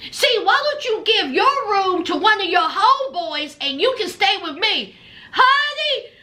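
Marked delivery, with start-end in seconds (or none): none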